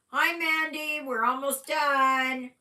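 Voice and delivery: loud, high call to someone nearby. Real, not acted. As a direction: call out across the room. Calling out to Mandy.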